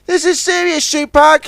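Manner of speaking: high tug voice, lightly stern; a hint of a stern warning. Ten Cents warns Billy, lightly stern. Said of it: "said Ten Cents sternly".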